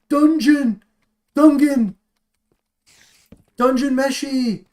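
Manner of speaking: loud excited yelling of the name; excited shouting. Real, not acted. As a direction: burst out shouting it with glee.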